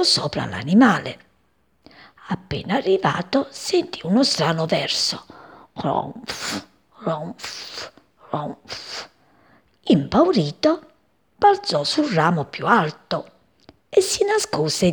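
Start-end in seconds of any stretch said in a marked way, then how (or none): 5.7-9.1 soft drawn-out snores fading away; gentle mock snoring sounds. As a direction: snore quietly like a sleeping cat. Sleeping cat snores in story.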